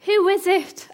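high, loud challenge; demanding. Acted. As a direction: act out a startled-awake commander demanding an answer. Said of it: "Abner demanded".

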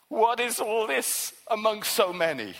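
ordinary delivery

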